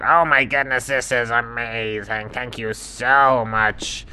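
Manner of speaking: put-on ostrich voice, gushing; amazed, effusive cartoon ostrich; grateful ostrich. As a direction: stretch out the thanks. Ostrich thanks dingo.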